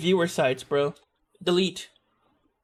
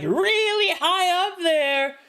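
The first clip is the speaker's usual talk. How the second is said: much higher, slow squeaky tone; 11 semitones higher, much slower than usual. Shown as a demonstration. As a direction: float it up high and thin. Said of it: "The shape of my voice".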